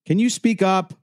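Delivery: high, loud, curt demand. A narrator's reading. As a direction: shout impatiently down the phone. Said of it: "I demanded".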